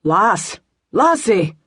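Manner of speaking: calling out in a Scottish brogue; rough Scots brogue, calling to rouse her. A narrator's reading. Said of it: "A gravelly brogue".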